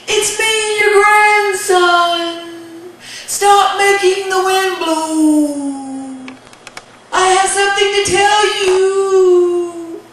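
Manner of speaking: high, drawn-out shouting; calling out. Acted. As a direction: holler each phrase up to a giant. Boy shouts up to giant.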